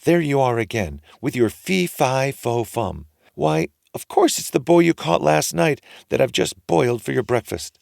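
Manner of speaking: high scolding wife; scolding ogre's wife. A narrator's reading. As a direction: nag him like a forgetful old wife. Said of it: "the ogre's wife said".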